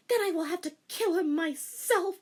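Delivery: high determined vow; grim resolve. A narrator's reading. Rei deciding to kill her father, determined. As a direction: vow it fiercely.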